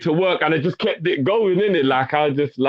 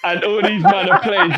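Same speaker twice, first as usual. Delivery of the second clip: laughing; 4 dB louder than usual. Real, not acted.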